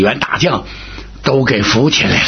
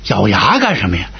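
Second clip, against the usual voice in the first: usual speaking voice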